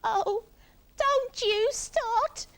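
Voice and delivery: high, loud, drawn-out protest; Iggy near tears. A narrator's reading. Iggy to the parrot, tearful wail. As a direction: wail it slowly, about to cry. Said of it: "and burst into tears".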